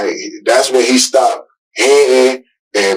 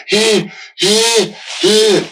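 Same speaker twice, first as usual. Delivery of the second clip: imitated gasping breaths; 9 semitones higher, 3 dB quieter, much slower than usual. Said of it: "And he was like".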